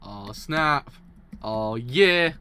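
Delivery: loud, thrilled outburst; hyped shout. Real, not acted. Streamer reacting to a manga twist, loud excited shout. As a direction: yell it out with real excitement.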